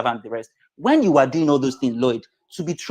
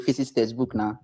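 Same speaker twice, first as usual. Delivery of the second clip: ordinary delivery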